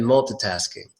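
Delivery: "multitasking" as mul-tuh-tasking; MUL-TUH variant. Shown as a demonstration. Teacher lists three ways, multitasking with reduced TUH. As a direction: say "multitasking" with a weak schwa after the T. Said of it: "three ways to say that word".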